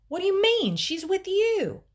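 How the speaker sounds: high, loud panicked protest; frantic, very scared. A narrator's reading. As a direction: cry it out in rising panic. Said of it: "sounded frantic and very".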